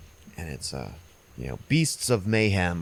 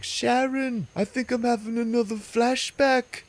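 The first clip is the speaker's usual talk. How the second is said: much higher dazed rock star impression; a spaced-out old rocker, pitched way up; slower than usual. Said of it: "and he's like".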